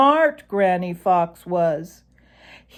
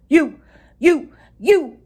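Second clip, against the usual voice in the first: high, loud sputter; sputtering rage; 10 semitones higher, 9 dB louder than usual. A narrator's reading. Reddy splutters at Sammy Jay, loud and high. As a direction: choke on your fury. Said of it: "began Reddy Fox".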